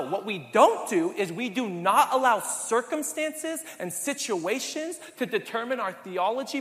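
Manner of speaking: ordinary talk, nothing marked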